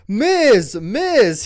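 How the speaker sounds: loud high call across the room. Retold or imitated. R-Truth calling to Kevin Owens, loud high call. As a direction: call out a name from a distance. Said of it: "R-Truth's voice in the background saying".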